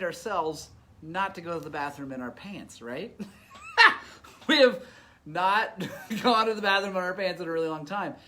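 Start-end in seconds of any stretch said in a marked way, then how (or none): 3.5-4.4 a loud, high laugh bursting out; high loud burst of laughter. Real, not acted. Laughing out loud at the memory of peeing himself. 4.5-8.1 laughing through it. Real, not acted.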